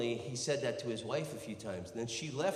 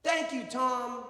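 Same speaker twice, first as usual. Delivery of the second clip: high, slow called-out yell; stretched-out demonstration shout; 11 semitones higher, 5 dB louder, slower than usual. Shown as a demonstration. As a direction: holler it out as a cue for the audience. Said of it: "I need another man to yell".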